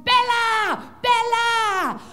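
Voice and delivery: loud high call across the house; excited shout. Pretend. Male pug shouting for Bella after a dream. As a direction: yell her name, bursting in.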